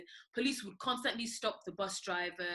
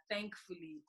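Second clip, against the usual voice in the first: ordinary delivery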